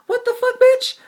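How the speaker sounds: high, loud shriek of disbelief; a squealed, disbelieving what the fuck; incredulous shriek. Retold or imitated. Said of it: "I'm thinking in my head".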